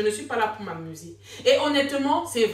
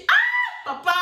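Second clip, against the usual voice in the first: high, slow, stretched-out "ah, papa!"; shocked cry; 6 dB louder, slower than usual. Retold or imitated. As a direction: cry out in disbelief, much higher. Her shocked cry at the father.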